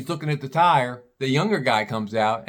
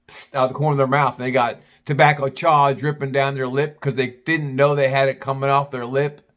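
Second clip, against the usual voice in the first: usual speaking voice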